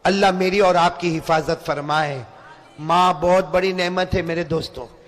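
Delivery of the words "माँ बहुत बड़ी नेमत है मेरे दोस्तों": ordinary talk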